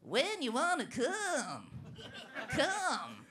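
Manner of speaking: slow nasal folk drawl impression; sing-talk it like an old folk singer; [name]. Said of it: "what would [name] sound like".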